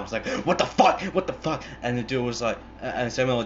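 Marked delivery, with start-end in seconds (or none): 0.5-1.6 high-pitched panicked outburst; freaked out. Retold or imitated. Man on the couch after a shooting, freaked out. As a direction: imitate a man freaking out in shock. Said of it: "freaked out".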